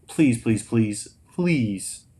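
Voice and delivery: usual speaking voice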